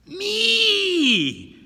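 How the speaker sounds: high, loud, nasal, whining shout; one sharp nasal ringing cry. A narrator's reading. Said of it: "It sang on one note".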